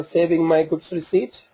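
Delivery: ordinary delivery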